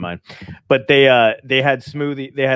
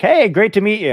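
higher, louder fake-cheery hello; mock friendly greeting; 10 semitones higher, 5 dB louder than usual. Old colleague greeting the bearded comic as a stranger, mock cheery. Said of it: "they're like".

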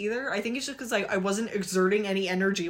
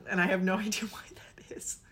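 laughing whisper; 5 dB quieter than usual. Real, not acted. Laughing aside about grades.

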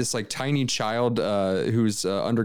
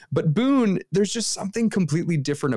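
excited; 8 semitones higher than usual. Real, not acted. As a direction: gush about him with delight. Excited praise of the child actor.

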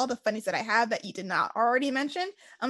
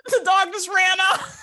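laughing; 8 semitones higher, 5 dB louder, slower than usual. Real, not acted. Laughing while retelling dog escape.

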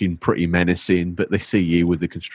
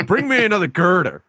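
much higher New York foreman call, laughing; New York accent; 12 semitones higher than usual. Retold or imitated. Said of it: "New York accent".

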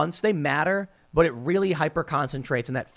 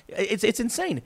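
usual speaking voice

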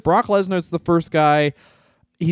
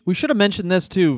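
usual speaking voice

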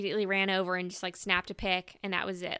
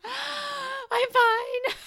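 laughing through tears; 6 dB louder than usual. Retold or imitated. Overwhelmed, crying on phone.

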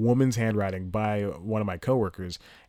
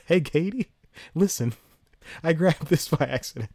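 put-on higher-pitched boyfriend on the phone; pretend phone call; 7 semitones higher than usual. Comic fake-calling his girlfriend from stage, higher innocent voice. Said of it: "I pretend to call".

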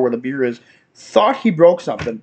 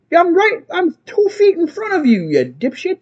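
high-pitched, indignant re-enactment; exasperated retort; 12 semitones higher than usual. Retold or imitated. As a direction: scold someone who is shouting from up close. Retort to yelling headphone wearer.

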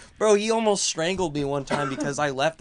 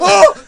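mock startled yell; 16 dB louder than usual. Grabbed by the throat, retold.

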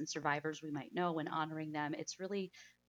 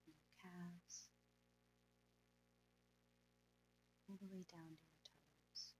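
soft spa whisper; 8 dB quieter than usual. Acted. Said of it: "let's go ahead and meditate".

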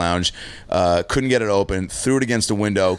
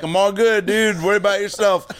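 put-on drunk self; 11 semitones higher than usual. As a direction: pretend you're totally fine while wasted. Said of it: "I'm like".

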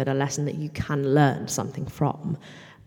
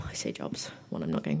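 whispered self-correction; whispered aside; 11 semitones lower, 7 dB quieter than usual. Real, not acted. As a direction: drop to a breathy whisper. Quiet aside correcting herself.

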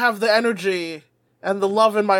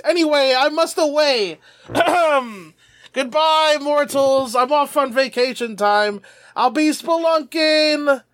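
higher, theatrical farewell; grand dragon farewell; 5 semitones higher than usual. Acted. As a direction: exit like a pompous dragon.

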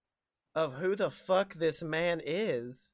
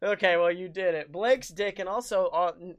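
ordinary talk, nothing marked

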